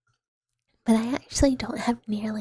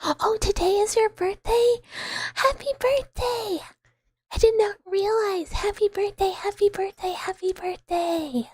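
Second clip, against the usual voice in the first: much higher, louder, delighted exclamation; excited birthday surprise; 9 semitones higher, 3 dB louder than usual. Real, not acted. Streamer learns it is a viewer's birthday, excited. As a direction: burst out high with surprised congratulations.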